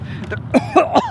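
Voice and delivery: sharp cough from a swallowed bug. Real, not acted. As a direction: hack it out. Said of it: "I think I just swallowed another".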